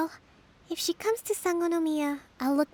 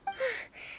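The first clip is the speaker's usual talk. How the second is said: a long tired sigh, pitch dropping; weary sigh; 10 semitones higher, 7 dB quieter than usual. Acted. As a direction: let the exhaustion out in one breath. Swamped by villagers' requests.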